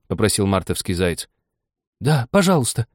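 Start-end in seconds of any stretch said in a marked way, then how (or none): 2.0-2.9 high-pitched, drawn-out plea. A narrator's reading. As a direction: stretch it into a coaxing request. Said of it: "протянула Аня".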